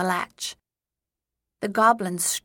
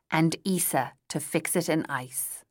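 ordinary delivery

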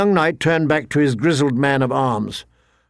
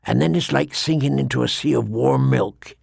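weathered veteran voice, often dropping low; grim old ranger; 3 semitones lower than usual. Acted. Old ranger warns young knight about the cold, grimly certain. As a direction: recall the killing cold from experience, low and measured.

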